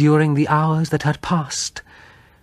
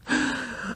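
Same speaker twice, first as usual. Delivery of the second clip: gasp; 13 semitones higher, 8 dB quieter than usual. Acted. Wife sees the golden hair.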